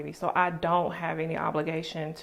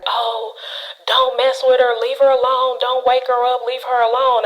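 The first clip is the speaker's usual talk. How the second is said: loud, higher defensive shout; mother yelling defensively down the phone; 6 semitones higher, 12 dB louder than usual. Retold or imitated. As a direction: snap back to protect the sleeping baby. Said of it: "she's like".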